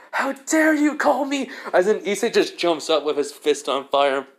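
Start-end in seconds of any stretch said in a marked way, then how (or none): 0.1-1.5 high-pitched mock outrage; indignant outburst. Stray Devil confronting the one who called it, indignant. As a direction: act offended, pitch jumping well up. Enraged devil voiced in story.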